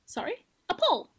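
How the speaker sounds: puzzled, rising "Excuse?"; confused surprise. Retold or imitated. Child at a hotel hearing "pool", baffled. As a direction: act baffled by the question. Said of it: "And I was like".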